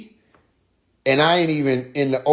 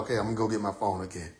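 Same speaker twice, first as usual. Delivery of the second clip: quiet; 6 semitones lower, 11 dB quieter, much faster than usual. Retold or imitated. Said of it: "I'm like".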